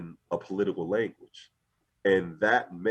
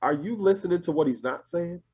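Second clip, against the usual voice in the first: higher-pitched incredulous question; pointed questioning; 9 semitones higher than usual. Retold or imitated. Speaker retells their reaction to a proud convention speech, pointed questioning. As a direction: ask it high, as a pointed challenge. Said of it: "And I'm like".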